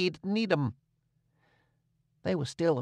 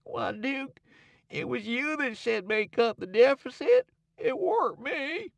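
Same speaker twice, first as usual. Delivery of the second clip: high, small timid protest, a touch teary; king defending himself to the Duke; timid snuffling; 9 semitones higher than usual. A narrator's reading. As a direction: whimper your excuse. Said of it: "timid and still a-snuffling".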